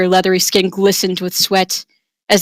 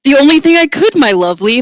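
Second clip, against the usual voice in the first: boastful hero; 6 semitones higher, 4 dB louder than usual. Acted. Marcus answers boastfully.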